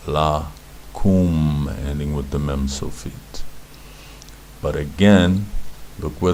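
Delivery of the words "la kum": "La", short pause, "kum" with a long oo; syllable by syllable. Shown as a demonstration. Teacher reads the verb out for learners, syllable by syllable. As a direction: say "La" and "kum" apart, holding each. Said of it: "gives the u sound to the kuf".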